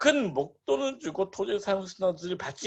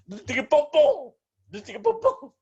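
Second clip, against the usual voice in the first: high-pitched comic exclamation, unlike usual voice; playful mocking; 10 semitones higher, 4 dB louder than usual. Teacher teasing would-be buyers who get conned, high comic mockery. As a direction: gleefully poke fun at the gullible.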